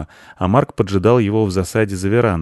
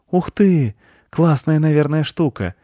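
put-on high wowed kid voice, easing down; delighted boy; 8 semitones higher than usual. A narrator's reading. Mark hears of magic nut, amazed. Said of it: "восхитился Марк".

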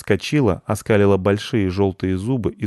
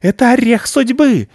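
much higher, much louder frenzied shriek; 10 dB louder than usual. Acted. Overexcited squirrel shrieks about the nut of destiny. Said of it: "визжала перевозбудившаяся белка".

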